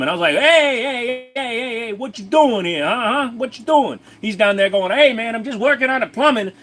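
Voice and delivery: put-on high, agitated New York accent; hammed-up angry New York accent; angry New Yorker. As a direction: rant like an indignant New York wiseguy. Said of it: "Can you do Angry New Yorker?".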